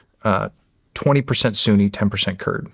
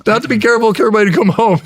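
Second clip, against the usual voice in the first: high, loud laughing quote; laughing retelling; 11 semitones higher, 6 dB louder, faster than usual. Real, not acted. Soldier retelling his wife's advice, laughing and high. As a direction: repeat it through laughter.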